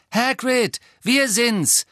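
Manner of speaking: hoch und laut rufen, durch eine Tür; gerufen. A narrator's reading. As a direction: ruf laut nach Hagrid. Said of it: "rief Harry durch das Schlüsselloch". (laut und hoch durch das Schlüsselloch gerufen)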